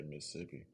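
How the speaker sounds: Mississippi said quiet and clipped, "Miss-sippi"; local pronunciation. Shown as a demonstration. Streamer repeating the local clipped Mississippi. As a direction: Mississippi swallowed into three quick syllables. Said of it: "you don't say the whole thing".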